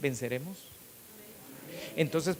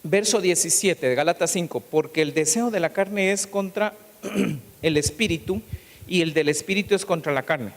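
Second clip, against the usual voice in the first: loud, raised sermon delivery; fiery preaching; 3 semitones higher, 6 dB louder than usual. Acted. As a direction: preach with full force to the congregation. Preaching at full force. (predicador en pleno sermón, con voz fuerte)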